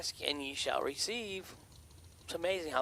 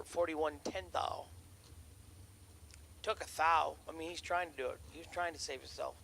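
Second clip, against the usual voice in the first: race announcer; 4 semitones higher, 4 dB louder than usual. Acted. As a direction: explain the result like a track caller. Live drag race calling.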